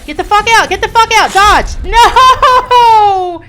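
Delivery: high, loud string of no's; desperate no's. Real, not acted. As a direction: yell in despair as it goes wrong. Desperate yelling as attack lands.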